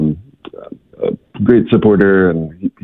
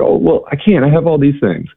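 higher, light apologetic voice; mild protest; 8 semitones higher than usual. Retold or imitated. Rhonda declining because of her bags, higher mild protest. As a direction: lift it up, a little helpless. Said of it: "And she's like".

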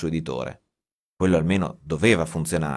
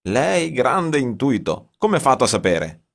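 slow, wide-eyed amazement, pitched up; astonished; 7 semitones higher, 4 dB louder than usual. A narrator's reading. Sergei amazed the writer guessed the plot, astonished. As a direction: gasp it out like he can't believe it. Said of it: "disse Sergei stupefatto".

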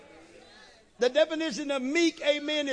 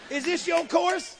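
sarcastic comeback; 5 semitones higher than usual. Retold or imitated. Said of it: "I wanna say".

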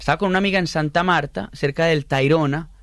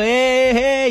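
imitated crowd holler; 10 semitones higher, 5 dB louder than usual. Mimics everyone cheering on boat.

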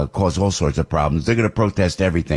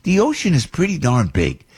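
ordinary talk

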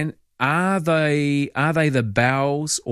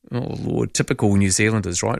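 usual speaking voice

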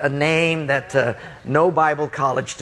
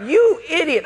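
high, exasperated acted outburst; outraged; 13 semitones higher, 3 dB louder than usual. Student to the prankster flooding his room, outraged. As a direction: burst out in high indignation.

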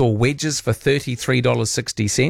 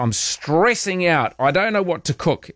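mock stressed housemate; 6 semitones higher than usual.

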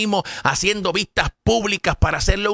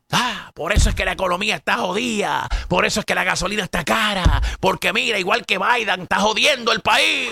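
higher, whiny hammed-up griping; mock partisan complaint; 5 semitones higher than usual. Commentator parodying voters blaming Biden. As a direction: imitate the opponents complaining. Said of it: "Eso es lo que estuvieran diciendo ustedes".

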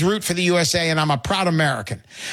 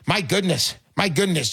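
ordinary talk

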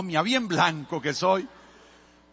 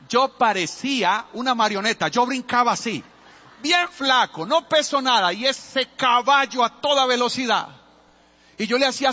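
higher and louder, bouncing with the memory; breathless storyteller reliving the gallop; excited retelling; 6 semitones higher, 4 dB louder than usual. Retold or imitated. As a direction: tell it like you're still on the runaway horse. Wild ride retold with excitement.

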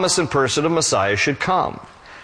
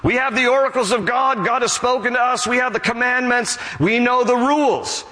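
higher-pitched, self-satisfied boast; imitated pride, pitched up; proud boasting; 6 semitones higher than usual. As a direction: mimic people priding themselves on their privilege. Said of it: "they pride themselves on having the law of God".

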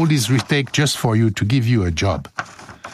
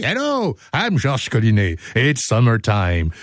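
normal talking voice

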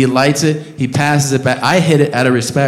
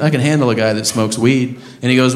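ordinary delivery

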